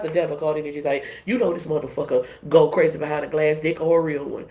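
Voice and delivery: ordinary talk, nothing marked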